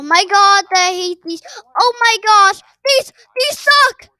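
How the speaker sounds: loud, rising annoyed yell; fed up with the traps; frustrated shout. Real, not acted. Hit by traps.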